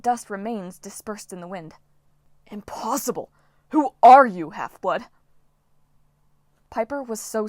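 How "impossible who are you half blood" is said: outraged monster wail, loud burst on who; outraged storm spirit. Acted. Storm spirit Dylan wailing in outrage at the half-blood. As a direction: storm spirit raging at the heroes. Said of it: "Dylan wailed in outrage".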